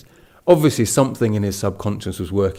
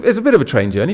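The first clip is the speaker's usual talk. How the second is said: normal talking voice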